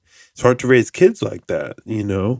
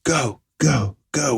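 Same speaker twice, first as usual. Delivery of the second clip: higher, hushed imitation of cheering go go go; mock cheering; 5 semitones higher, 3 dB quieter, slower than usual. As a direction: lightly act out a family cheering him on. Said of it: "We're all like".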